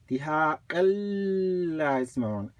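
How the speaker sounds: exaggerated long L linking "دِهَاقًا" to "لَا"; emphasized shadda. Shown as a demonstration. Teacher emphasizing so you can see, stretched L on "لَا". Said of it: "I emphasize it more".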